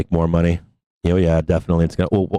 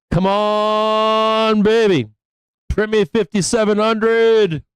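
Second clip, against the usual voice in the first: pleading yell at the chart; 11 semitones higher, much slower than usual. Real, not acted. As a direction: shout at the screen, willing the trade to print. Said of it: "Sorry for yelling".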